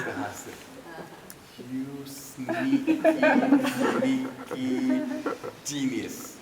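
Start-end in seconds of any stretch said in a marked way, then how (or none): 1.7-6.4 admiring accusation. Retold or imitated. Realizing she set it up.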